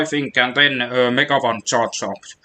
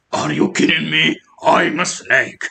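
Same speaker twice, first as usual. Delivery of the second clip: lightly voiced stealth soldier, slowing down; a hint of a covert operative; Snake impression; 3 semitones higher than usual. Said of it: "That's my Codex Snake impression".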